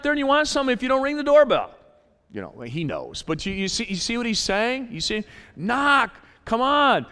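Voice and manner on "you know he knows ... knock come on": ordinary talk, nothing marked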